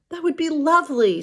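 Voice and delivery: high, delighted princess voice; a lilting, happy princess. Acted.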